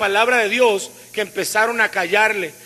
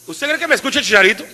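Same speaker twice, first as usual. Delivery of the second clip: normal talking voice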